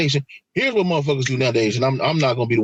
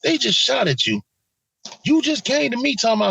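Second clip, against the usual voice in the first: incredulous; 10 semitones higher than usual. Retold or imitated.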